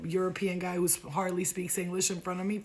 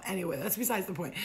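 normal talking voice

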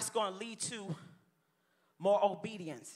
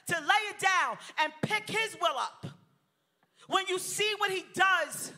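high, loud, fervent preaching to the crowd; full-force preaching; 5 semitones higher, 4 dB louder than usual. Acted. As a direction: build the fire and drive it home.

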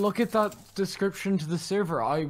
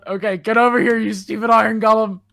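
shouting; 5 semitones higher, 7 dB louder than usual. Real, not acted. Fighting the golem.

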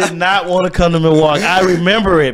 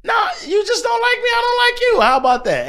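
high, shouted mock defiance; mock confrontation. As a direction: yell it like squaring up to a hater. Acting out confrontation with a hater.

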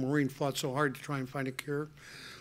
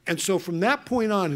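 ordinary delivery

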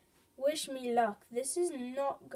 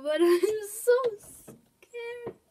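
laughing nervously; 9 semitones higher, 7 dB louder, much slower than usual. Real, not acted. Nervous laugh before match.

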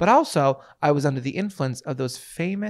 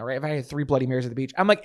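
ordinary talk

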